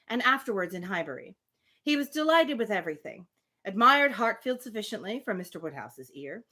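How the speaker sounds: measured read-aloud voice for a classic novel; story narration. A narrator's reading. Reading a novel chapter to the listeners, in a steady narration voice. Resumes reading after audio fix.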